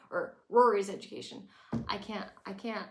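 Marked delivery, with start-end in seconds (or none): none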